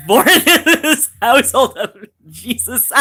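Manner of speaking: words squeezed out through loud laughter; can barely get it out for laughing; laughing hard. Real, not acted. Laughing outburst at chat.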